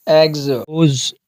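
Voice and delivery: एग्स and रोज drawn out slowly, each ending in a z sound; slow word demo. Shown as a demonstration. Teacher sounding out plural endings slowly. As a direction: stretch एग्स, then रोज. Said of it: "जैसे".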